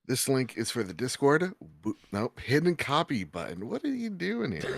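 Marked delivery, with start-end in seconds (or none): 3.7-4.8 high squeaky mock voice; squeaky surprised voice. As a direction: pop up in a squeaky silly voice.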